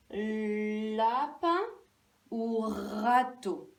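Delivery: "Lapin ou râteau" slow, long l and long r; first sounds stretched. Shown as a demonstration. Teacher offering the choices, first sounds stretched. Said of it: "c'était la".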